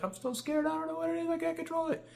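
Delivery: imitating a scared, very high voice; freaking out. Imitating his frightened date between demonic bursts, high panicky voice. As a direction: mimic panic in a tiny high-pitched voice. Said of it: "she started freaking out".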